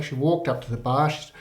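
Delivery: ordinary talk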